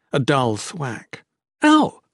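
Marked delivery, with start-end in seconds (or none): 1.6-2.0 sharp high yelp of pain; cry of pain. Acted. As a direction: cry out as if just struck. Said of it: "Murta cried out".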